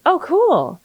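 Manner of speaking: light, high-pitched delight at an easy win; acted happy surprise; pleasantly surprised. Acted pleased reaction to easier result.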